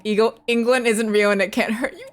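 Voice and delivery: normal talking voice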